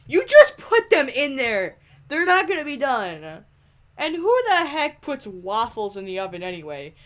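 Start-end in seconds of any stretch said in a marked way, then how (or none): 0.1-4.7 exasperated outburst. Real, not acted. Scolding Freddy.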